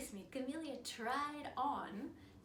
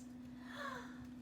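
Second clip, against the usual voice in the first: gasp; 11 semitones higher, 7 dB quieter than usual. Acted. Narrator gasps at rainbow girl.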